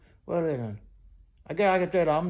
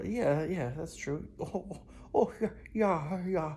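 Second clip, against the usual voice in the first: ordinary talk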